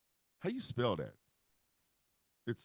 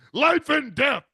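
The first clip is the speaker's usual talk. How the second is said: louder, higher pronouncement; grand declaration; 8 semitones higher, 8 dB louder, slower than usual. Pretend. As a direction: proclaim it grandly. Divine voice declares.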